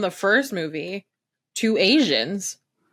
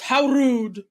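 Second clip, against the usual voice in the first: snooty how rude; 5 semitones higher than usual. Retold or imitated. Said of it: "And he goes".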